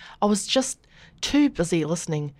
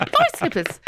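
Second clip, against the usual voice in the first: put-on New Zealand accent. New Zealand comic pronunciation, high.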